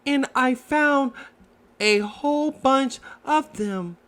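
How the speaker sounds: high little Piglet voice, stammering; timid Piglet. Acted. Piglet answers Roo, high and shy.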